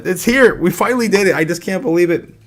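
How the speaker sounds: usual speaking voice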